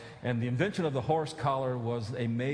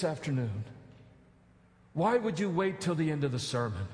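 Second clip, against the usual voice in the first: preaching appeal; 4 semitones higher than usual. Acted.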